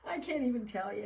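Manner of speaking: words squeezed between laughs; laughing. Real, not acted. Trying to retell a post, helpless laughter. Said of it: "I'm about dying laughing".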